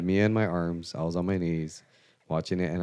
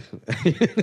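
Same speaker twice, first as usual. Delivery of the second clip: sudden high laugh; bright laughing burst; high burst of laughter; 11 semitones higher, 6 dB louder than usual. Real, not acted.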